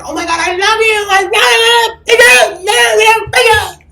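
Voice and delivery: shrill, drawn-out mock fangirl scream, very high. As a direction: scream it loud like a starstruck fan, stretching the words. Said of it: "I'm not gonna be screaming at these celebrities".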